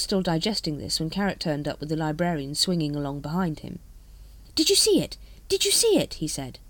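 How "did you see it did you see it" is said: high, loud excited outburst. A narrator's reading. Carrot rushing to tell Vimes about the dragon, excited. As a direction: burst out with it, pitched right up.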